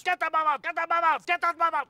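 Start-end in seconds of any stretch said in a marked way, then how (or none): none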